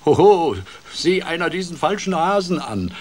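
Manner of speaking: loud, high booming mockery; booming, amused. A narrator's reading. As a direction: boom it out with gleeful scorn. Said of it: "dröhnte der Fuchs und schlug sich vor Vergnügen".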